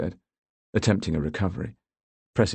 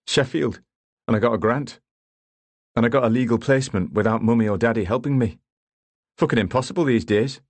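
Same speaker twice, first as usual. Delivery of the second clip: bitter retort; 5 semitones higher, 4 dB louder than usual. Acted. Daniel's resentful retort to Bamber.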